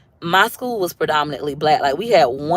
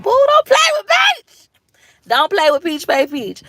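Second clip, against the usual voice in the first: very high, loud sing-song taunt; sassy; 12 semitones higher, 4 dB louder than usual. Acted. To her viewers, bragging about her groups, high sassy squeal. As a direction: squeal it with playful attitude.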